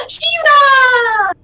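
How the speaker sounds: high, loud, drawn-out call of a name; excited cry. Acted. Dex calling to the warg, excited cry. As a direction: cry out in delighted recognition. Child spots the beloved warg.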